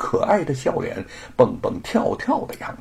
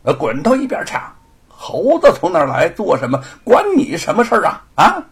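higher, louder soldier snarling him off; rough cursing; 6 semitones higher, 6 dB louder than usual. Acted. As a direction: bark at a nobody to get lost. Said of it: "骂道：".

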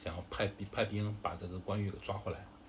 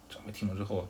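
plain reading voice

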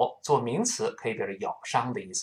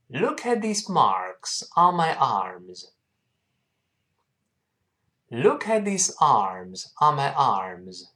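慢速领读；放慢速度，逐词拉长地示范朗读；带学生慢慢读整句；慢读示范，词词清楚; slower than usual. Shown as a demonstration. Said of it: "跟老师慢慢读一下这句话".

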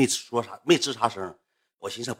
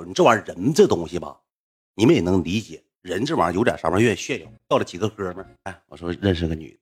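usual speaking voice

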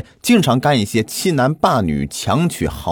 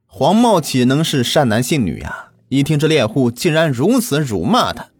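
plain reading voice

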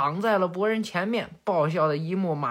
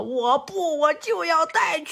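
put-on high-pitched little-kid tantrum; stubborn child; 9 semitones higher, 3 dB louder than usual. Little sister fighting her brother for a teddy, stubborn child. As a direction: dig in like a small child refusing to give in. Child in tug-of-war over toy.

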